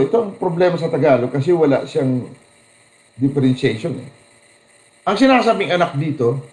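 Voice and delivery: usual speaking voice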